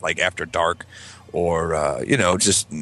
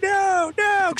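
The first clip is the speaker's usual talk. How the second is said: very high mock-panicked cry; kid crying out. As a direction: wail it in falsetto as a punished child. Kid crying out under the belt.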